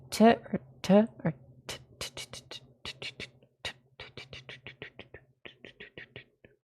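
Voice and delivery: testing "t" sounds, fading to whispered ticks; rapid unvoiced "t, t, t" clicks; hard and soft T. Shown as a demonstration. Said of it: "a hard T or a soft T".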